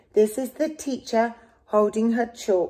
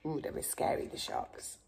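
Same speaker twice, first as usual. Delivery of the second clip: scary whisper; 8 semitones lower, 16 dB quieter, faster than usual. Real, not acted. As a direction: whisper it like a little secret scare. Aside to child over book.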